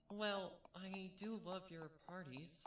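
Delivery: high, slow and sweet younger sister; little sister gently agreeing; smiling Bellamina. Acted. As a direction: say it fondly with a smile, slowly. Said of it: "Bellamina smiled".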